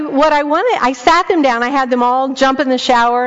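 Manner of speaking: ordinary talk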